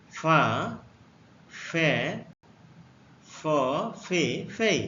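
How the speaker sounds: ফা, ফে, ফ, ফে, ফেই said one by one, slow and clear; teacher modelling ফা ফে ফ ফে ফেই; letter sounds demo. Shown as a demonstration. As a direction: model ফা ফে ফ ফে ফেই with a pause after each. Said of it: "এফ এ".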